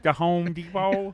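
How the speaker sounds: mock question.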